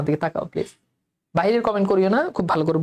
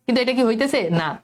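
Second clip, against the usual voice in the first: ordinary talk, nothing marked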